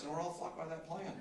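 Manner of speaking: ordinary delivery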